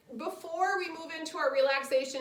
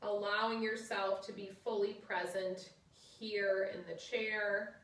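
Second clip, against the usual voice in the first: soft, low guided relaxation voice; 3 semitones lower, 5 dB quieter than usual. Acted. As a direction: ease them into rest gently. Leads final relaxation.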